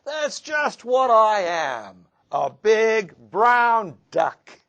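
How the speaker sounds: high, loud and slow, a fox hamming it up as a duck; silly stranger duck voice. Acted. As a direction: boom it out like a proud fake duck. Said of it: "said the stranger with a waddle and a strut".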